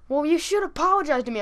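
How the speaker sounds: lightly voiced indignant homeowner; mock indignant demand. Homeowner demands an apology from the boys, mock indignant.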